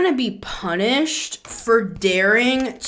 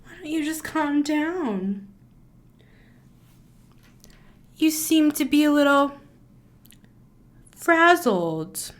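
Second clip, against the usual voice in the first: condescending mock; 7 semitones higher than usual. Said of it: "They're like".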